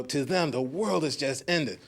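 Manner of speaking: ordinary talk, nothing marked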